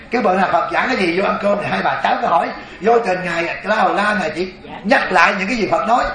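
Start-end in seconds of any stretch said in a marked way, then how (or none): none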